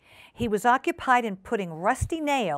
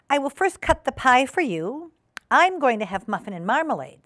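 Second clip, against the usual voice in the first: lightly voiced hostess, pitched up; polite hostess Ribby; 6 semitones higher, 4 dB louder than usual. Ribby serving pie to Duchess, polite hostess voice. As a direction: announce it politely, a little louder.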